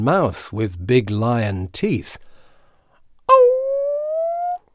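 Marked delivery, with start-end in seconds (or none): 3.3-4.6 very high, tiny squeak; mouse squeak. A narrator's reading. Little mouse seeing the lion's teeth, high squeak. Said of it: "squeaked the mouse".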